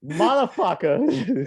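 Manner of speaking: slow, loud, high Boston mimicry; imitated Boston accent. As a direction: echo it drawn out in a Boston accent. Echoes the Boston accent demo.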